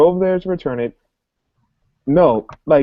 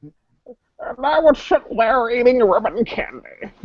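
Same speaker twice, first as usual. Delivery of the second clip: put-on very old man; 6 semitones higher, slower than usual. Joining the old man bit.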